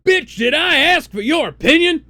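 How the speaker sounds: high, loud, sassy outburst; shrill snapping reply. Acted. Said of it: "But he responded".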